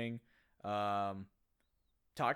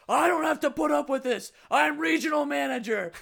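mock yell, much higher and louder; imitating a screaming dad; 13 semitones higher, 11 dB louder than usual. Retelling Will Ferrell's dinner-table blowup, mock screaming. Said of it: "And then Will Ferrell's like".

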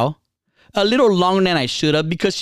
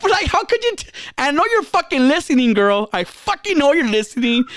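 outraged; 8 semitones higher than usual. Real, not acted. Outraged at listener girl.

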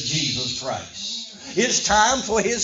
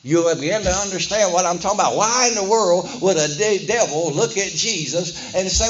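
normal talking voice

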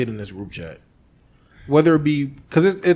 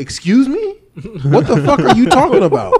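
mock outraged disbelief; 7 semitones higher, 6 dB louder than usual. Retold outraged reply to "no bet.

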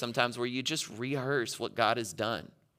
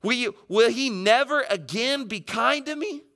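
high, pleading protest; acted cry of rejection; shouting at God; 11 semitones higher, 3 dB louder than usual. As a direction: demand an answer in a raised, high voice. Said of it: "You ever shout that at God?".

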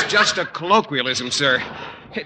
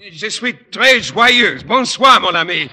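gushing French; 4 semitones higher, 5 dB louder than usual. Acted. Teacher gushes in French.

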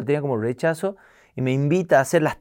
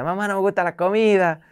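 lightly voiced whiny child; a kid complaining about dinner, high; 6 semitones higher than usual. Child whining at aunt's house.

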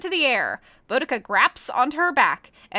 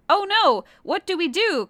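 higher, louder alarmed cry; 6 semitones higher, 4 dB louder than usual. Acted. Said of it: "cries Boudica".

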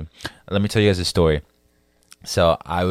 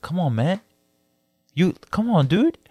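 imitated high-pitched coaxing; high, pleading pep-up from the vendor; encouraging food-truck guy; 10 semitones higher than usual. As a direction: cheer a buddy up, voice pitched way up. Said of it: "he's like".

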